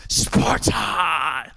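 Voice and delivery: drawn-out movie battle cry, held back; growing Spartan cry, lightly acted; mock war cry. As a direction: give a mock warrior's cry. Quoting the film's war cry.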